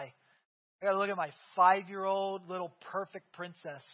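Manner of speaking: normal talking voice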